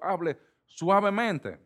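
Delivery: ordinary talk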